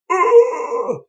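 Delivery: high, loud crushed groan; a sharp pained grunt as the last rock lands; dying grunt. Retold or imitated. Giles Corey crushed by the final rock, high loud groan. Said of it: "and Giles Corey goes".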